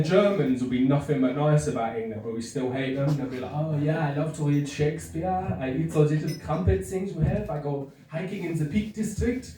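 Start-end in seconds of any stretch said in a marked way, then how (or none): 3.5-9.5 mock German accent. Germans being nice about England.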